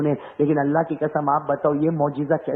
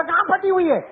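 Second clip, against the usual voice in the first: indignant question; 11 semitones higher than usual. Retold or imitated. Young man asks indignantly.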